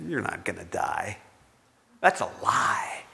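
soft, conspiratorial whisper; hushed tempter whisper. Acted. Satan whispering to Eve, hushed and sly. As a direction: lean in and breathe the lie.

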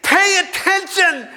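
high, hammed-up shout at another driver; mock road rage. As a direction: yell at the car ahead in exaggerated outrage. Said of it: "I started discussing it with him".

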